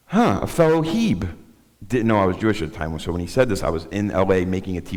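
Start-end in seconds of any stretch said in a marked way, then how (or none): 0.1-1.3 put-on higher, slow, knowing old man voice. Recovery friend greeting him at group, lightly voiced wry old man. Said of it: "came up to me and said".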